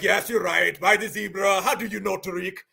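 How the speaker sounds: imitated West African caller; caricatured West African accent; mock West African accent. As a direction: put on a West African accent, amazed at being placed. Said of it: "That sounds like West Africa".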